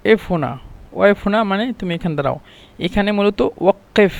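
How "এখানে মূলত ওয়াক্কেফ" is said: ordinary delivery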